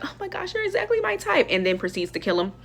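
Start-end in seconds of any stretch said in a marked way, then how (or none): 0.0-1.5 high, breathless crush voice; swooning fangirl Zoe. Retold or imitated. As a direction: gush like she just saw her crush. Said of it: "Zoe's like".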